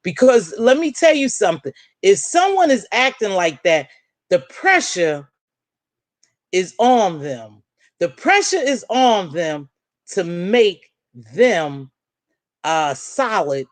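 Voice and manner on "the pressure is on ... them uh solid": slow and emphatic. Real, not acted. Driving home her point.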